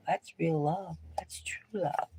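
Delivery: soft whisper, trailing away; hushed reverent whisper. Acted. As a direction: whisper it as if sharing a secret. Hushed climax about true love.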